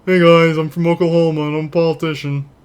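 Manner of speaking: a hint of a glad-handing politician; lightly voiced politician greeting; weird politician voice. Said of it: "that's my".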